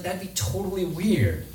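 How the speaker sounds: high, drawn-out imitated disbelief; mock weirded-out guy. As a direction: stretch it out, imitating someone put off. Acted reaction of the weirded-out guy.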